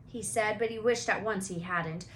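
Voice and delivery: ordinary delivery, nothing marked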